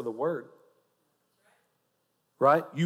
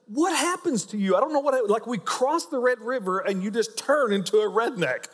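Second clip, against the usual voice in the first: imitated wife in a higher voice; mock-incredulous wife, pitched up; exasperated wife; 7 semitones higher than usual. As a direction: question him like a baffled wife. Acting out his exasperated wife.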